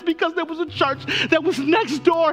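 slowing climax. Real, not acted. Pastor bringing the congregation to a slow climax.

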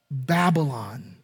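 usual speaking voice

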